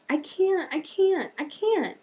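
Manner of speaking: put-on high, whiny little-kid I can't; toddler whine. Aunt imitating nephew struggling with Duplo blocks, high whine. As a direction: sound like a frustrated two-year-old. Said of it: "he's been saying".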